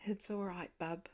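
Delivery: soft, quiet reassurance; father calming his scared son; gentle comfort. A narrator's reading. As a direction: murmur it tenderly.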